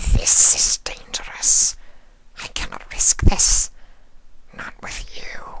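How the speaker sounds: slow breathy alien whisper; whispering changeling. Acted.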